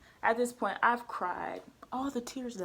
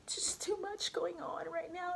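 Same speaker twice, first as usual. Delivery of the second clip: whispered despair; 10 semitones higher, 11 dB quieter than usual. Retold or imitated. Venting about failing school.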